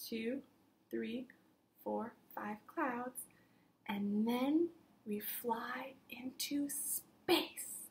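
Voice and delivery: slow hushed whisper. Acted. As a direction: breathe it softly like floating into space. Whispered counting drifting into space.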